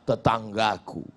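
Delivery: low, soft mock answer; meek complainer. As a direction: answer the tuyul low and sheepish. Acted complainer answers the tuyul.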